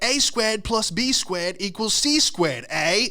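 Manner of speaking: high, slow mock teacher recital; Canadian math teacher. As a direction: chant the formula as a Canadian teacher. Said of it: "I had a Canadian math teacher".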